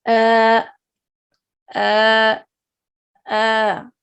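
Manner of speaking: अ opened with a hard catch, then held long; jerked onset, lengthened. Shown as a demonstration. Teacher drilling hamza with alif, jolted then long अ. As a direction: hit अ sharply and stretch it steady. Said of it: "झटका से हम पढ़ेंगे, लेकिन इसको लंबा कर देंगे".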